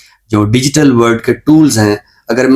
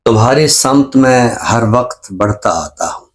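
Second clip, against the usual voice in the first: ghazal recitation. Acted. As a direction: savour each line of the couplet. Recites his ghazal on air. (غزل کا ٹھہرا ہوا ترنم سے پاک پڑھنا)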